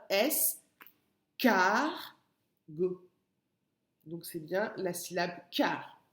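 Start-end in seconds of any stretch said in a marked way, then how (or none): 0.1-3.1 "S, car, go" as three detached syllables. Shown as a demonstration. Teacher asking pupil to listen well, "S, car, go" syllable by syllable. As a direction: separate "S, car, go" with clear pauses for the listener. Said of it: "Écoute bien".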